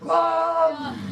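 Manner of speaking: loud, high wild "aah"; short high-pitched yell; wild pupils' scream. Acted. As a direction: scream like a class going wild. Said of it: "they go really wild, like".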